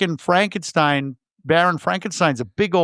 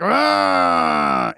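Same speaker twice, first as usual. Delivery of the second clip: much lower monster growl; monster roar; 10 semitones lower than usual. Retold or imitated. Host acting out the monster grabbing the wife, low roar. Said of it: "he's like".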